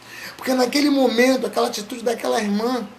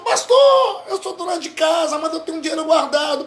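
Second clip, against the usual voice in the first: eager offer; 6 semitones higher, 3 dB louder than usual. Retold or imitated. Housewife offering money.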